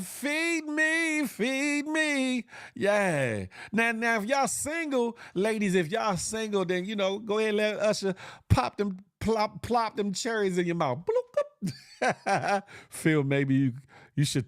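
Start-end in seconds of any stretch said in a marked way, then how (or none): 0.0-2.4 high eager feed me; high mock plea. Imitating the girl at the concert, high eager plea. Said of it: "she said". 11.0-12.6 laughing pop sound. Retold or imitated. Mimics cherry pop while laughing.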